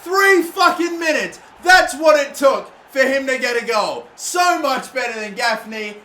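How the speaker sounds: loud, high celebratory yelling; real joy bursting out; excited shout. Real, not acted. Gamer celebrating his substitute's goal, loud and thrilled.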